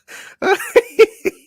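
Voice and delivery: high, loud delighted laugh; gleeful laugh. Real, not acted. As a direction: burst out laughing. Gleeful reaction.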